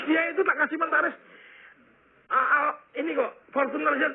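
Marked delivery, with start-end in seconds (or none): 2.3-4.1 choked up. Real, not acted. Father upset by son's debts.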